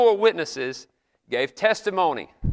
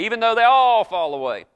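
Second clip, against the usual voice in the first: higher, louder mock boast; Peter's bold boast; 6 semitones higher, 6 dB louder than usual. Peter boasting to Jesus he won't fall, mock-brash. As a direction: play a brash disciple insisting.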